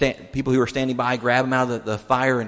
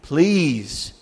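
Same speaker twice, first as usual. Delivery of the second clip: soul begging; 4 semitones higher, 4 dB louder than usual. Retold or imitated. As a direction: beg from the soul, stretching each word. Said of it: "His soul is begging".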